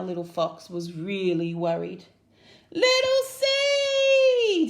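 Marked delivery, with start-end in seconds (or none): 2.7-4.7 very high, loud, slow drawn-out shout. A narrator's reading. Worried little Fox shouts for Seed, high and drawn out. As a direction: stretch the name out in a long shout. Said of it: "he shouted".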